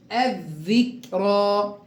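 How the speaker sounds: الذكرى slowly with a held double th as in "this"; slow recitation. Shown as a demonstration. Teacher drills the sun lam, slow recitation. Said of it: "كيف نقرأ هكذا".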